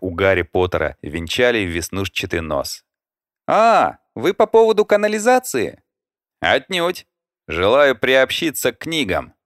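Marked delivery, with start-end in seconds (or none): 3.5-5.8 put-on high young woman voice; perky girl, pitched well up. A narrator's reading. 6.4-9.3 smiling. A narrator's reading. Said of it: "с улыбкой ответил Влад".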